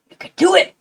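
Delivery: high, loud pep-talk cheer; rally them like a coach. Acted. Cheering on those working tonight.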